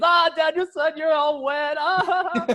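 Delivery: high, loud mock guffawing taunt; imitated laughing. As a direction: impersonate a teacher cracking up at his pupil. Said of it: "And he's laughing".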